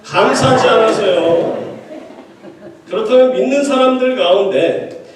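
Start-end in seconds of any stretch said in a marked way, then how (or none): 0.0-1.7 firm, raised congregation response; rallying response. Shown as a demonstration. Preacher restarts the congregation's drilled answer, strong rallying response. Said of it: "다시 시작".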